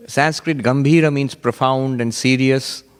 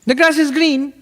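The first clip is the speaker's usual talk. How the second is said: high-pitched voice; 14 semitones higher, 3 dB louder than usual. Retold or imitated. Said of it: "He had a high-pitched voice".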